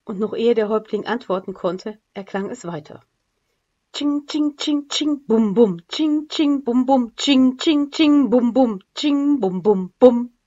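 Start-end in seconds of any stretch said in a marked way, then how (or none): 3.9-10.3 loud vocal drum and crash cymbal; drum and cymbal imitation. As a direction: beat out a timpani and cymbal rhythm with the voice. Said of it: "die große Pauke mit dem Messingbecken".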